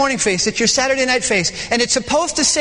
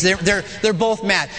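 usual speaking voice

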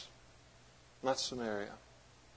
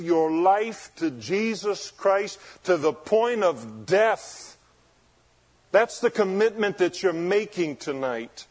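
raised voice, weighty drawn-out phrases; forceful preaching; 6 semitones higher, 6 dB louder than usual. Acted. Preacher urging converts to give their lives, forceful preaching. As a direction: press the commitment on them solemnly and firmly.

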